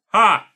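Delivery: one loud barking laugh; explosive ha. A narrator's reading. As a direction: blurt out a single scornful ha. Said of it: "Norris burst out a single explosive".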